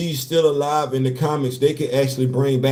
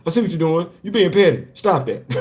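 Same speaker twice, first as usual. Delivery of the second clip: normal talking voice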